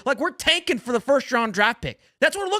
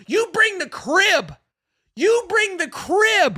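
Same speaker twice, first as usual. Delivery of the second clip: higher, louder, slowed shout at the play call; exasperated shout; 8 semitones higher, 3 dB louder, slower than usual. Real, not acted. Fan on stream yelling about the goal-line play call, exasperated shout. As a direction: yell it like you can't believe the call.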